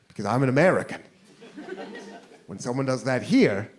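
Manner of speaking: normal talking voice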